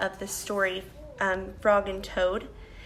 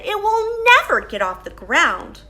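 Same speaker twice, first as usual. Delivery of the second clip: discouraged Toad; 8 semitones higher, 7 dB louder than usual. Acted. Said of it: "he said".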